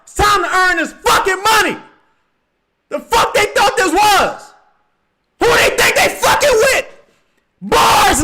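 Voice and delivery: all-out high, loud hype scream; hyped screaming. Real, not acted. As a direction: lose it completely over the play.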